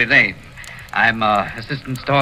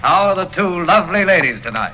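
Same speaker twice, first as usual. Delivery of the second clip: high, louder cheery greeting; playful; 7 semitones higher, 4 dB louder than usual. Acted. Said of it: "he was feeling playful".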